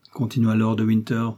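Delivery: usual speaking voice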